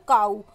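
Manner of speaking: "काऊ" said loud, gliding from a to u; diphthong demo. Shown as a demonstration. Teacher pronouncing cow loudly to show the diphthong. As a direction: stretch "काऊ" so both vowel sounds are heard. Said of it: "डायपथॉन्ग का जैसे example".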